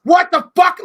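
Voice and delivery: high, loud sudden shock; shocked outburst. Retold or imitated. To a friend, acting the shock of remembering, loud high outburst. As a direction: blurt it out in horror. Sudden memory of drunk behaviour.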